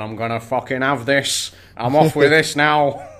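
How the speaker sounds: lightly voiced teenage scally; cocky baby T-Rex. Baby T-Rex makes off with the kill, cocky. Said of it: "back to the baby T-Rex going".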